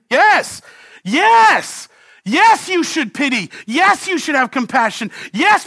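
high, loud, impassioned pleading; full-force preaching. Acted. Preacher to congregation, begging God for compassion. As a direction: cry it out to heaven, pleading.

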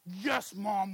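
sweet yes mom with a smile. Retold or imitated. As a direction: make it sound cheerful. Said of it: "with a smile".

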